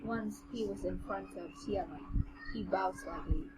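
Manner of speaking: measured, even audiobook read; story narration. A narrator's reading. Narrator reading a fantasy novel to the co-host, story narration. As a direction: read the tale aloud with composure.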